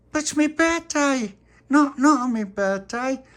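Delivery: high, loud, slow whine; wailing birthday boy. Acted. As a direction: protest shrilly, stretching each birthday. Tony protests.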